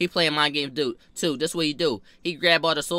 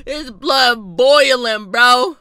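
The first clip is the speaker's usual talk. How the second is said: loud, slow and higher, stretching every word; drawn-out mock roar, much louder; hammed-up yell; 7 semitones higher, 10 dB louder, much slower than usual. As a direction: bellow it out like a mock outrage. Hammed-up recap of his rage.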